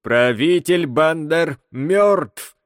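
slow, every syllable stretched; drawing out syllables. A narrator's reading. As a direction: drag each syllable out slowly. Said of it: "растягивая слоги".

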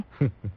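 low booming guffaw; loud guffaw. Acted. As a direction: let out a big hearty belly laugh. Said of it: "hô hố cười rộ".

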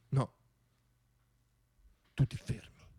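ordinary delivery

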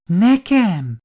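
surprised. Acted. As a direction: caught completely off guard. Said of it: "Lepődött meg az idős asszony". (meglepett, magas felkiáltás)

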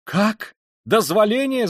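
high, loud incredulous cry; outraged yell. A narrator's reading. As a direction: explode at the very idea. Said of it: "завопила Катерина Ивановна". (возмущённый вопль)

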